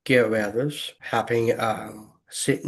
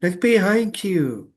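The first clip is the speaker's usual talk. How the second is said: high imitated warning to the screen; talking to the TV; 8 semitones higher, 3 dB louder than usual. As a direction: call out higher, as if warning a film character. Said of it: "he just talking to the T V".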